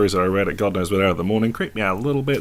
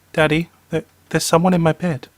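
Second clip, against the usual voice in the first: high-pitched child voice, voiced stage whisper; frightened child's whisper; 9 semitones higher, 4 dB louder than usual. A narrator's reading. Boy under the bed whispers to his father, high childlike voice. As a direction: pitch it up like a scared little boy.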